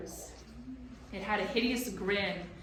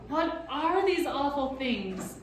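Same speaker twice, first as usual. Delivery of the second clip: high, slow, frightened gasp; gasping fright; 7 semitones higher, 6 dB louder, slower than usual. A narrator's reading. Boy frightened by the monsters, gasping. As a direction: ask it in alarm. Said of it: "Ben gasped".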